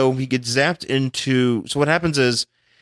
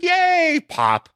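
squealed high yay; chirpy gleeful cheer; cheery squeal. Retold or imitated. Girl cheering after kill.

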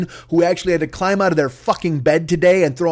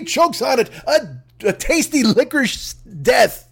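hammed-up outraged customer; 3 semitones higher than usual. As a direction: play a hysterical rich lady demanding violence, over the top. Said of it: "she'd be like".

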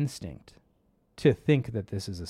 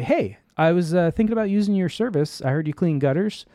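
higher, louder, chirpy put-on caller voice; fake customer; 5 semitones higher, 5 dB louder, faster than usual. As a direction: sell the innocent-customer act. Scammer poses as friendly customer.

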